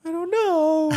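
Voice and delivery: very high, loud mock wail; hammed-up little-kid crying voice; whiny little kid. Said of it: "I was like a little kid".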